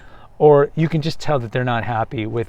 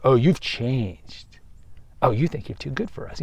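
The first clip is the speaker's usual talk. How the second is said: passive aggressive; 5 dB quieter than usual. Retold or imitated. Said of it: "They might pull the passive aggressive".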